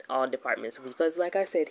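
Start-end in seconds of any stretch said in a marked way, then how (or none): none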